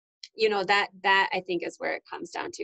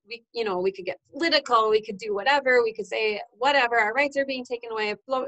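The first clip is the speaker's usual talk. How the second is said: ordinary talk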